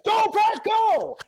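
loud, very high cheer; escalating shout. Retold or imitated. Fans trading the chant back, escalating shout. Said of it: "And then he said".

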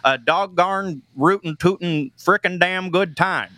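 a hint of a Midwest accent, folksy and easy; Iowa Midwest accent. Comedian does his Iowa in-laws' Midwest accent for the radio host. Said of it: "this is just how my in-laws talk".

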